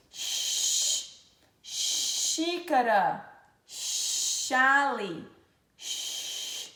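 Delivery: long shushing "x", drawn into "xícara". Shown as a demonstration. Teacher showing sound of X, sustained "sh". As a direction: stretch the sh opening of "xícara", slowly. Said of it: "exemplos de som do X".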